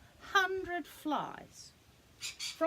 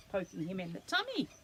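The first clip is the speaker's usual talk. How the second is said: plain reading voice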